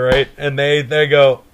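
ordinary delivery

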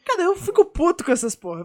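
suddenly high and loud outburst; heated rant. Real, not acted. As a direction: blow up about it, pitch jumping. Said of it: "Calma, calma, calma".

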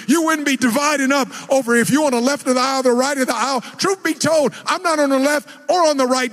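full-force preaching, rising. Acted. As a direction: preach it with fire.

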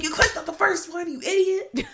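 high-pitched incredulous scolding, half laughing; mock outrage. Scolding a friend who bought an essay, mock outraged and high. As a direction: squeal it in disbelief at a foolish friend.

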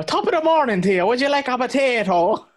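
high, hammed-up stage-Irish accent; mock the Irish brogue way up high; fake Irish accent. Listener mocking the trailer's accents, exaggerated Irish lilt. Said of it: "what are these accents".

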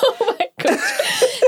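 high, laughing exclamation. Real, not acted. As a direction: burst out laughing as you say it. Laughing reaction to praise.

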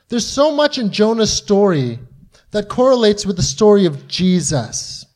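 ordinary delivery

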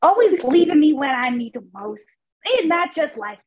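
high, exasperated grumble; annoyed lament. Acted. Character lamenting that God left him, high and annoyed. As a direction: gripe about being let down. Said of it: "He's getting very, very annoyed".

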